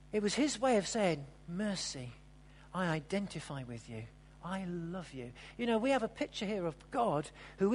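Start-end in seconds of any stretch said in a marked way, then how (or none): 1.5-5.3 softer, lower and gentle; Jesus's quiet tender words to her. Acted. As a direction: speak it softly as a loving reassurance. Jesus speaking to healed woman.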